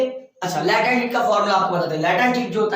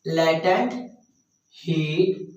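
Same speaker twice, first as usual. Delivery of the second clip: slow dictation; 4 semitones lower, much slower than usual. Shown as a demonstration. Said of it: "क्या बोलते हैं बोलो?".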